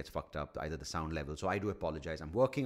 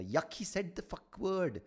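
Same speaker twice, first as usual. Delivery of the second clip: prudish disgust; 9 semitones higher, slower than usual. Retold or imitated. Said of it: "some people are".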